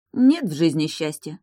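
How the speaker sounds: plain reading voice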